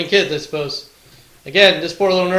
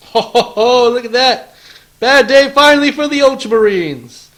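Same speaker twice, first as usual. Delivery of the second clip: high, loud excited crowing; gleeful gloating; 7 semitones higher, 7 dB louder than usual. Real, not acted. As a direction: crow over a great dice roll. Gloating over a great dice roll.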